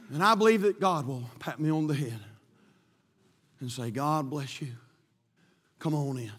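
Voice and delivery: low, soft, tender welcome; a hint of a kind God welcoming home; gentle God.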